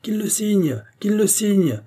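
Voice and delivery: high-pitched insistent chant-like call; crowd's cry. A narrator's reading. Deputies demand a signature from all sides, high outcry. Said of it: "s'écria-t-on de toutes parts".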